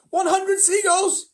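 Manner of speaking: very high, loud, slow shout. A narrator's reading. James announcing his catch, triumphant shout. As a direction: yell the tally triumphantly. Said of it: "he shouted".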